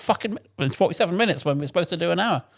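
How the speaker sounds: high, incredulous telling-off; exasperated rant. Retold or imitated. Mechanic berating his rider for running out. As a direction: disbelief at running out of fuel.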